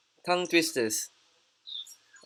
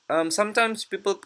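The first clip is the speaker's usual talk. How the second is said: normal talking voice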